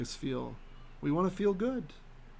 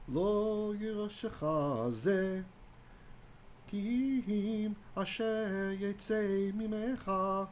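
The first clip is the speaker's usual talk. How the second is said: unhurried, raised cantillating read of the Hebrew verse; slow Hebrew recitation; 5 semitones higher, 3 dB quieter, much slower than usual. Shown as a demonstration. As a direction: chant the Hebrew slowly, higher than speech. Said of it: "Verse four, let's see it".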